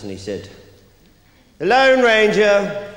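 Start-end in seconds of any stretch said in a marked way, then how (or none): 1.6-2.9 slow, loud, grand announcing of a guest; pompous announcer. Retold or imitated. Posh party doorman announcing guests, slow and pompous. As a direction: boom out each name like a posh doorman. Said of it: "and he's all pompous".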